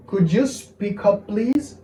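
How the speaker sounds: careful slow teaching pace, lifted at the end; slow model phrase. Shown as a demonstration. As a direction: draw out each word for learners.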